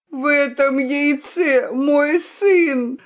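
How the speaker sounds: a father groaning in anguish. Acted. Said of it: "застонал петух".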